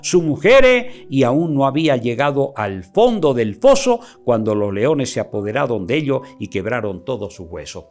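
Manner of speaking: loud, declaiming Bible reading; preaching scripture. Acted. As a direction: proclaim the verses to the congregation. Preacher reads scripture aloud.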